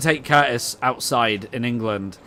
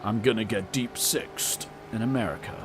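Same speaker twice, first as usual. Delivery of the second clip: softer mock American accent; bad American accent; 3 semitones lower, 8 dB quieter than usual. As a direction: try on an American accent, badly. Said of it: "my American would be, like, offensively bad".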